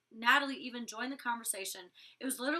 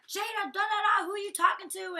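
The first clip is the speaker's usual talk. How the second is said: high, louder imitated challenge; mock confrontation; 9 semitones higher, 7 dB louder than usual. [name] jumping in to confront Jada, mock confrontation. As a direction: jump in loud and high.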